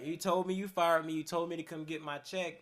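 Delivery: re-argue it, heated but half-performed; retold argument.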